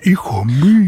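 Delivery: lightly voiced elegant gentleman. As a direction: mimic a refined father, lightly. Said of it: "habla así porque es elegante".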